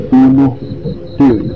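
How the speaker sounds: usual speaking voice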